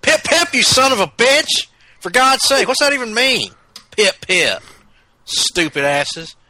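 high, indignant wrestling-announcer bluster; Jim Ross impression. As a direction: sputter it out, high and fed up. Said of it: "I wonder what that would sound like".

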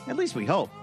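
usual speaking voice

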